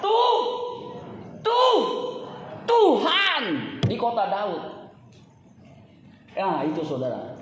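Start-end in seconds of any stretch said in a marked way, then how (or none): 0.0-4.6 stuttered build-up to a drawn-out shout; emphatic proclamation. Real, not acted. Preacher announces the angel's title for Jesus, emphatic shout. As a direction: break off on a high, loud syllable, then release the word.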